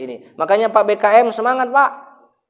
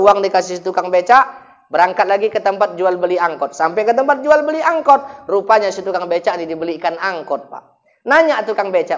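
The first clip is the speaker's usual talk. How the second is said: usual speaking voice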